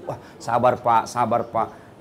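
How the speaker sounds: low, soft consoling words; calm him down gently; soothing. Retold or imitated. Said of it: "consoling the widower". (menghibur dengan lembut dan pelan)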